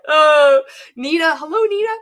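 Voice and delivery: delighted cry. Real, not acted. Greeting a viewer excitedly.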